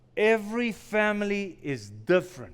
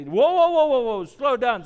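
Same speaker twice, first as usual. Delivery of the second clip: put-on louder, higher "whoa" to halt a worked-up child; calling to calm; 4 semitones higher, 6 dB louder than usual. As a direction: call out to stop them. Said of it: "we've had to stop them and say".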